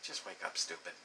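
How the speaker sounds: very soft, low-energy dream voice; other self, hushed. Acted. Dream self telling the sleeper to wake, hushed. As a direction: say it barely above a murmur. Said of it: "my other self is like".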